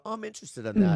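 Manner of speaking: high, loud quote of himself; retold keen interest. Host retells his reply to a game tip, keen interest. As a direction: pop up in pitch and volume, acting out interest.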